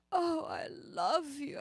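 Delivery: slow, high, weepy love line; hammed-up classic movie romance; dramatic old film actress. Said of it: "so dramatic".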